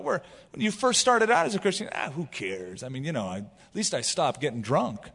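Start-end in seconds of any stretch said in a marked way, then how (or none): 1.9-5.1 low, soft careless shrug. Retold or imitated. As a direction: toss it off like it doesn't matter.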